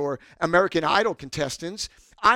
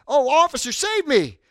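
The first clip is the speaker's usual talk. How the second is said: loud, high mock plea for rescue; crying out; 9 semitones higher, 8 dB louder than usual. Citizen appealing to police, mock crying out. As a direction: call up high for help. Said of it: "we could cry out".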